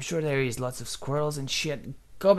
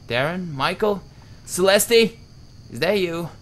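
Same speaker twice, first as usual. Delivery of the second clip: higher, louder calling into the mist; 6 semitones higher, 10 dB louder than usual. Real, not acted. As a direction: call for lost friends.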